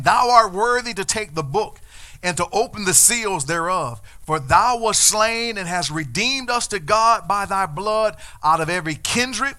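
loud King James reading; booming scripture reading; proclaimed scripture. Acted. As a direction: ring out the verse.

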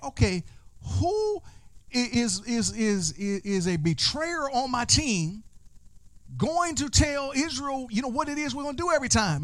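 high, stammering bewildered king; baffled king. Acted. Enemy king to his servants, high and bewildered. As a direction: puzzle aloud over who the traitor is.

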